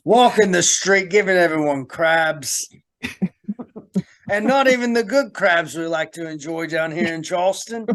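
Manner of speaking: mock British accent sliding into Australian; hammed-up Australian-British storyteller; British accent gone Australian. Host jokes about the prostitute ghost, British-turned-Australian accent. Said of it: "my British accent turned into an Australian".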